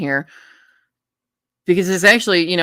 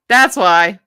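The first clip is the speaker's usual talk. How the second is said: emphatic realisation; 7 dB louder than usual. Real, not acted. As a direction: punch it up as a discovery. Repeats remark with emphasis.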